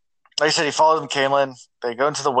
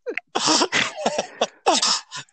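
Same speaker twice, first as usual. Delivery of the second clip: laughing hard. Real, not acted. Friend's funny username.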